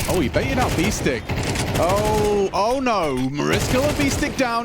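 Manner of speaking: rising hype play-by-play call; esports caster. Acted.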